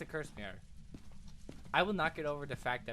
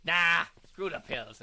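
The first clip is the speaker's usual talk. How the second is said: defiant; 2 semitones higher, 9 dB louder, slower than usual. Real, not acted. Bold reckless declaration.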